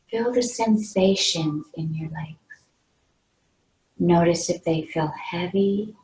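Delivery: unhurried meditation guide, pausing between phrases; guided meditation. Real, not acted. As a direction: lead them gently into relaxation, leaving long pauses. Leads a meditation.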